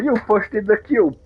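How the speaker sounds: mock a ridiculous excuse for shooting; mock petty accuser.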